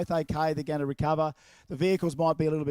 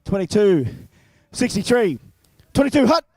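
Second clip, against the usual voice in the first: higher, much louder quarterback call; hammed-up quarterback shouting the count; shouted snap count; 3 semitones higher, 8 dB louder than usual. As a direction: yell the play across the field. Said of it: "Quarterback time".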